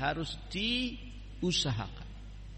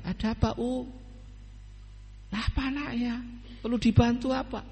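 higher, caring neighbour asking what's wrong; concerned neighbour; 9 semitones higher, faster than usual. Pretend. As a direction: ask gently, a neighbour offering help. Neighbour voiced asking about child. (tetangga yang peduli, suara lebih tinggi)

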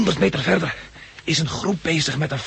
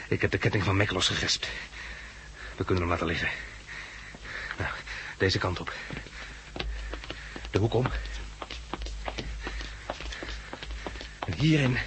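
softer, low-voiced while hiding; quiet urgent guiding in hiding; hushed; 5 semitones lower, 9 dB quieter than usual. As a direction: keep it down so he won't hear. Hiding from the gunman.

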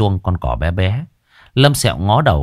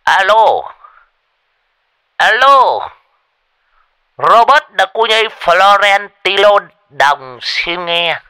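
high, loud pompous announcer; grand phone greeting; 11 semitones higher, 5 dB louder, slower than usual. Acted. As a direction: answer like a star. Old man answers phone grandly. (nghe máy ra vẻ oai)

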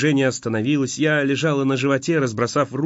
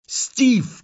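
shouted; 12 semitones higher than usual. A narrator's reading. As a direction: scream his name in panic. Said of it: "крикнула я".

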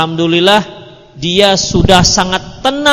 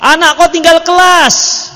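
high, slow yell from the gate; yelling wife; 9 semitones higher, 5 dB louder, slower than usual. Retold or imitated. Wife yelling bad news at husband as he arrives, high loud call. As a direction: shout the bad news before he's in. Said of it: "jangan teriak".

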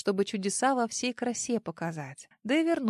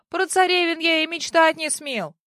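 loud, higher justifying himself; defensive protest; 6 semitones higher, 5 dB louder than usual. A narrator's reading. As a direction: protest your innocence. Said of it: "Оправдывался он".

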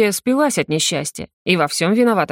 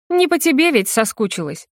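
higher, teasing mockery; mocking; 6 semitones higher than usual. A narrator's reading. Ex-wife mocks Belov, mocking tone. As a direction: needle him with a smirk. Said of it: "насмешливо отвечает".

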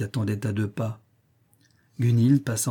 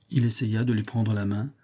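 ordinary delivery, nothing marked

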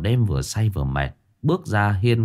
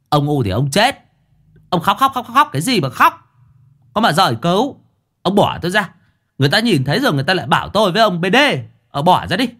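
much higher, louder, fast exasperated scolding; exasperated master; 9 semitones higher, 4 dB louder, faster than usual. Acted. Master pushing off clinging disciple, exasperated. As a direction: shove him off, fed up.

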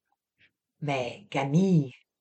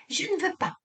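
protestation aiguë et apeurée de Camille; protestant; 13 semitones higher, 6 dB quieter, faster than usual. A narrator's reading. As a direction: recule en refusant, voix aiguë. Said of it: "protesta Camille". (Camille refuse, apeurée, voix aiguë)

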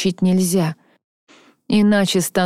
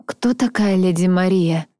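ordinary delivery, nothing marked

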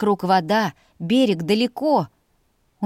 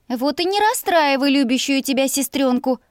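higher-pitched, mildly sulky; hurt grumble; 5 semitones higher than usual. A narrator's reading. Sister coaxing her reluctant brother, hurt grumble. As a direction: grumble as the wounded little sister. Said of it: "обиженно проворчала я".

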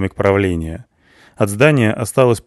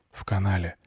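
quiet radio callout; 2 semitones lower, 10 dB quieter than usual. A narrator's reading. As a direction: answer softly over the radio. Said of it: "тихо сказал в микрофон Борух".

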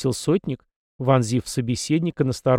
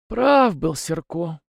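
slow, pensive musing; the old man musing slowly after a pause; thoughtful; 5 semitones higher, much slower than usual. A narrator's reading. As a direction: weigh each word slowly. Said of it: "задумчиво произнёс старик".

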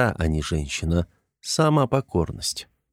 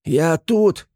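frantic yell; 8 semitones higher than usual. Acted. Trapped gremlin calling to Artyom through the smoke, frantic yell. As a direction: scream it out to be found. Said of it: "кричал истошный голос".

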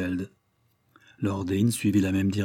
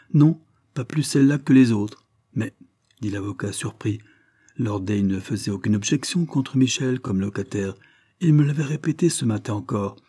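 usual speaking voice

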